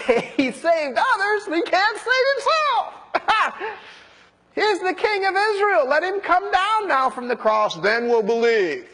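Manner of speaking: very high, scornful taunt broken by a laugh; mocking. Priests and elders taunting Jesus on the cross, mocking. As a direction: jeer at him in a high heckling voice. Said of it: "the elders mocked".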